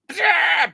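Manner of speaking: high, loud blurted blast noise; shouted toy-battle sound effect; mock laser blast. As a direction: yelp out a cartoon explosion.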